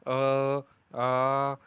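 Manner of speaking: "अ, आ" sounded out loud and clearly; teacher calls out the vowels for pupils to repeat; loud vowel demo. Shown as a demonstration. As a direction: project "अ" and "आ" for the class to copy. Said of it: "और अनुनुनासिक में".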